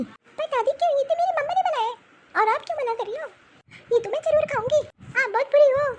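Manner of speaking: put-on a little girl near tears talking back to grandma; crying child defending her mother's noodles; tearful child.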